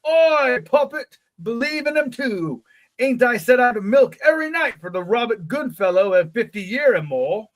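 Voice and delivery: high-pitched, hammy English rustic; exaggerated old English country dialect; funny old country English accent. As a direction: go all out as an old English villager with a broad accent. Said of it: "that funny accent that all the old people".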